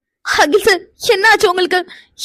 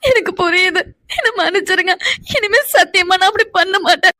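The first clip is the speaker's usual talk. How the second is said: weepy plea for forgiveness; crying, swearing to change; tearful apology. Acted. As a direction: promise through tears. Crying wife promises and apologises.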